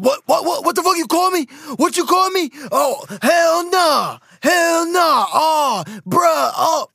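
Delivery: hammed-up outraged shouting, very high; emotional outburst. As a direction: blow up in mock disbelief at an insult. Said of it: "'cause they're emotional".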